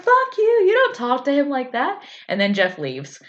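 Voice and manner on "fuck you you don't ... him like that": high, loud imitated outburst; hammed-up indignant scolding; mock outrage. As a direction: leap up high as if defending the boss. Acts out teammates attacking Jeff.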